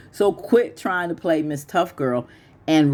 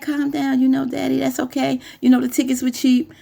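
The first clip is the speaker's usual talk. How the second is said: put-on lightly higher sweet-talking girlfriend voice; girlfriend soothing; 8 semitones higher than usual. Girlfriend soothing her man, higher mock sweet voice. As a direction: coo at him to calm him down. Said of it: "So she's like".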